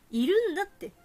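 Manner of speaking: almost yell it to make them listen; insistent shout. Real, not acted. Insisting fans do exist. (必死に言い張る大声)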